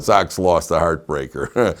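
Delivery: normal talking voice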